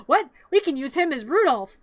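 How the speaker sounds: imitated excited discovery after a gasp; mock happy surprise. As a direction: play the crowd's sudden bright idea. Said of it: "they're all happy 'cause".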